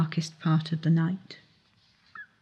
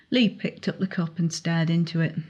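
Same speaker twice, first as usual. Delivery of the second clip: usual speaking voice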